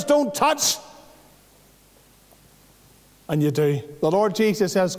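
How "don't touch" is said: high, stern command; firm command. Retold or imitated. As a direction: say it as a divine command. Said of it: "The Lord says".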